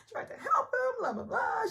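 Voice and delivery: high, loud hammed-up wail; mock fake crying. Mocking Ramona crying over her brother, high and loud. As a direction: ham up a fake sob story. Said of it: "She starts fake crying".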